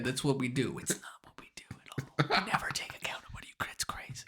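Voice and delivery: trailing into a whisper; muttered aside. Acted. Political aside about America, fading whisper. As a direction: let it sink to a whispered mutter.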